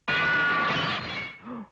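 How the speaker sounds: sharp gasp of alarm; startled gasp. Acted. Mister Fussy hearing a crash outside, gasping. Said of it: "heard a crash outside".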